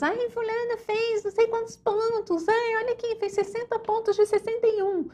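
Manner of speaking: high-pitched imitation of panicked students; mock desperate. Students phoning the teacher in despair over rivals' scores, mock desperate. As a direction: whine it frantically, far above your own pitch. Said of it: "vocês me ligarem desesperados".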